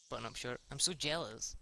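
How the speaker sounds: ordinary talk, nothing marked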